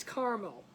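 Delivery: caramel as CAR-mul, two syllables; regional caramel. Retold or imitated. Host guessing at regional speech, caramel as CAR-mul. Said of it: "way north or way south".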